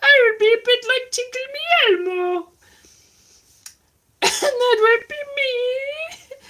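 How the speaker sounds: going outside again voice. Pretend. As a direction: squeak like a puppet rusty from lockdown. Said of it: "what that voice was".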